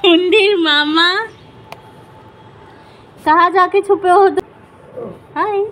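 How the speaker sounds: high, sing-song hide and seek call. Real, not acted.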